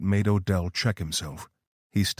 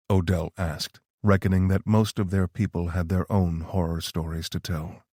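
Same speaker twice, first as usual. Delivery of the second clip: ordinary delivery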